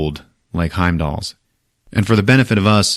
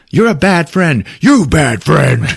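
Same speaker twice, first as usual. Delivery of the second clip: raised, high retold squabble; childish name-calling; 7 semitones higher, 5 dB louder, slower than usual. Two friends calling each other names, mock angry. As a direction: pitch it up and draw out the last insult. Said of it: "they're calling each other".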